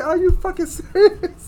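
high, slowed, disbelieving cry; incredulous disbelief. Retold or imitated. Retelling his reaction to the news, high incredulous disbelief. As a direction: act stunned that anyone buys it. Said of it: "I said".

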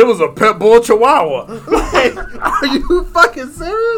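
high, laughing through the words; laughing disbelief. Real, not acted. Mocking the pit bull chihuahua story, laughing in disbelief. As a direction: crack up at the absurdity.

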